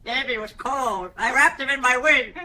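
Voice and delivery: a low, hammed-up cooing penguin; mock tender bird. Penguin nurse boasting about its warm wing, low and mock tender. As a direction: lay it on thick like a proud feathered nurse.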